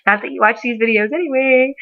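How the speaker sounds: ordinary talk, nothing marked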